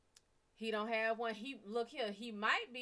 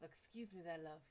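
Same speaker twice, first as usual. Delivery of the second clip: soft, smooth British accent imitation; 2 semitones lower, 7 dB quieter than usual. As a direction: quietly play the British charmer. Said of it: "Idris Elba come up with his real voice".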